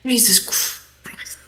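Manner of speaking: slow breathy whisper. Real, not acted. A player whispers at friends giving their names away. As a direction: mutter it in disbelief.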